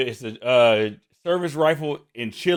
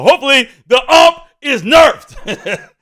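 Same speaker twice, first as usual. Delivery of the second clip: laughing; 12 semitones higher, 13 dB louder, slower than usual. Real, not acted. Laughs hoping gun gets nerfed.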